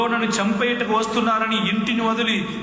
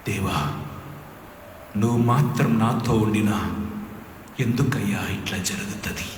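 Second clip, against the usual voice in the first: pleading prayer; 10 semitones lower than usual. Shown as a demonstration. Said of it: "ప్రార్థన చేయండి".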